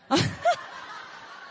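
airy, high burst of laughter. Real, not acted. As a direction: laugh at your own cheeky request. Laughs at own joke.

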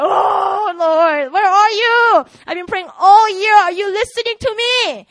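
high, loud wailing prayer; hammed-up anguished plea to heaven; crying out. Worshipper asking if God is listening, crying out. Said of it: "like, God crying".

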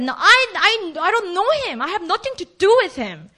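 high, insistent, stammered protest; emphatic denial. Acted. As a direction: insist heatedly on knowing nothing. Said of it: "curse word to emphasize that".

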